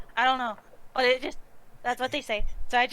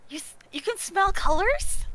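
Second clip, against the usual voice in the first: amazed; 6 semitones higher than usual. Acted. Character amazed at smelling colors.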